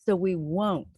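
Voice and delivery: slow, sing-song read-aloud; reading to children. Acted. Storyteller closing the book for young listeners, sing-song. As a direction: read it gently like a bedtime rhyme.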